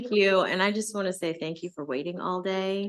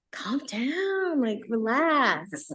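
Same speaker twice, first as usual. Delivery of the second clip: high, slow mocking whisper of a teenager; mock teen whisper, slow and high; imitating her teen son; 7 semitones higher, 3 dB louder, much slower than usual. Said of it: "he says to me".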